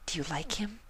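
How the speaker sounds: soft, high gentle pony; Fluttershy voice. A narrator's reading. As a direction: ask it softly and shyly.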